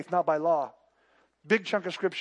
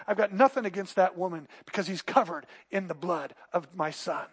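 ordinary talk, nothing marked

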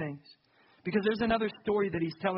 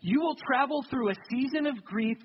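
raised, slowed, emphatic preaching; preaching at full force; 5 semitones higher than usual. Acted. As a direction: drive the point home to the congregation.

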